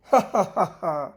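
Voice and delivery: short gloating laugh with an odd timbre; triumphant cackle. Acted. Nagina gloats over the enslaved Garalgant with a cackle. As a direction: laugh as the trap snaps shut on him.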